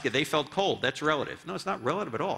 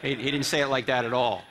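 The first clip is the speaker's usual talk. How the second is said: ordinary talk, nothing marked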